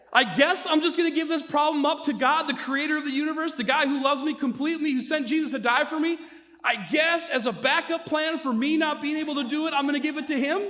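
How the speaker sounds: high-pitched, mocking disbelief; exasperated, rising-pitch self-mockery; incredulous repeat. Shown as a demonstration. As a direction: throw the words back as an absurd question. Repeats own words in disbelief.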